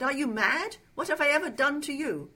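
loud, high-pitched outrage; angry demand. A narrator's reading. Trapped man challenging his captor, outraged demand. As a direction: fire the questions out in alarm and anger. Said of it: "he demanded".

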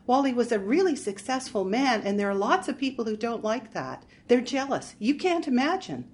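put-on girlish and breathless, pitched well up; breathless little girl. A narrator's reading. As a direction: plead like a helpless, tearful little girl. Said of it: "Her voice was breathless, like a little girl's".

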